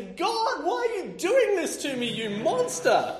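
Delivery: high, loud hammed-up outburst; comic fury at God; mock rage at God. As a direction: yell at heaven over a broken printer. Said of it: "I rage. I say".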